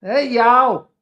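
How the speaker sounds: put-on high, loud Southern "Hey, y'all" in a Tennessee accent. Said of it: "We love that Tennessean accent here".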